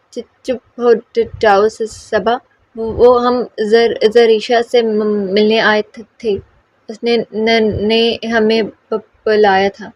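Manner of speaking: very slow, broken stammer; stuttering. Acted. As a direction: force each word out past the catch. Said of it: "اس طرح بولتی".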